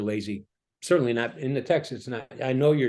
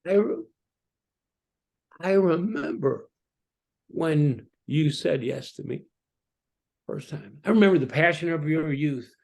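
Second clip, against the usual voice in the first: higher, slow, tender voice of God; God remembering; 4 semitones higher, 3 dB louder than usual. Acted. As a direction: lover recalling first passion. Voicing God's tender memory.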